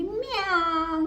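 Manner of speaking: high, slow drawn-out meow; cat meow. Acted. Teacher voicing the cat mocking the dog, meowing. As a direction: mew like a teasing cat. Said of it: "बिल्ली बोली".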